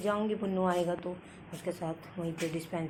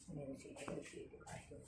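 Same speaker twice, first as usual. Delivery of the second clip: whisper; 4 semitones lower, 19 dB quieter than usual. Real, not acted. Coaxing dog softly.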